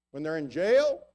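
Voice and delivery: ordinary talk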